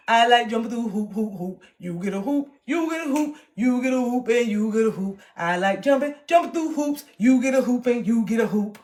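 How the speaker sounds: high mocking singsong. Mocking her people-pleasing, teasing singsong. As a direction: chant it like a taunt.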